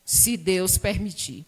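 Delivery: imitating her father.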